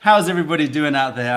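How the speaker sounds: cheerful host calling to the whole chat; calling out to viewers. Real, not acted. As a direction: hype the chat awake.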